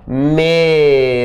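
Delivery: drawn-out syllables; teacher stretching the word; slow demo. Shown as a demonstration. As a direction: spell it out slowly for the class. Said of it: "se eu pegar essa palavra aqui".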